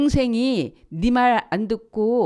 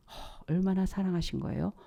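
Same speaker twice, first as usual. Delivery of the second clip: much softer whispered wonder; awed whisper; 8 dB quieter than usual. Real, not acted. Preacher marveling at God's love in a whisper. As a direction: whisper it in awe.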